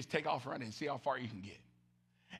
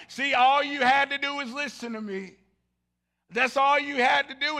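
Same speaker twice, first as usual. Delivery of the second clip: louder, higher know-it-all jab; mock told-you-so; 8 semitones higher, 13 dB louder than usual. Fan yelling at the team, mock told-you-so. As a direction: rub it in like a sideline coach. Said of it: "you're like".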